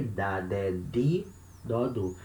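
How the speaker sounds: "di" said as plain "dee", like the other syllables; hard D syllable drill. Shown as a demonstration. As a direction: recite with a hard d throughout, "di" included. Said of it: "you can also say".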